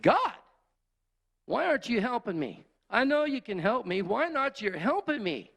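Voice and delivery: high, pleading cry out to God; loud, high, imploring outburst; anguished yell. Retold or imitated. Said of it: "I just yelled out".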